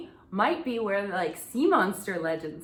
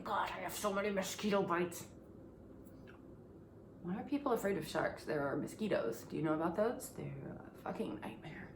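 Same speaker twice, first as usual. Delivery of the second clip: much softer, trailing into a whisper; muttered aside; 3 semitones lower, 13 dB quieter than usual. Real, not acted. Complaining to herself about mosquito bites, under her breath. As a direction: mumble it to yourself, fed up.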